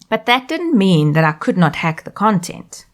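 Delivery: ordinary talk, nothing marked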